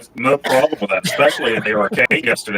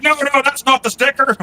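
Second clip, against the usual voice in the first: much higher squeaky shopkeeper impression; mock shopkeeper; 13 semitones higher than usual. Imitating the booth guy correcting the price, high-pitched. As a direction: play the flustered seller protesting. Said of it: "the guy's like".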